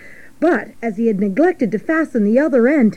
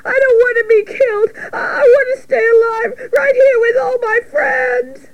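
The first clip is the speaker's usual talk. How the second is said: very high, wailing piglet voice; shrill, desperate squeal of a pig; hysterical crying. Acted. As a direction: bawl it in full panic. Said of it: "cried Wilbur, racing up and down".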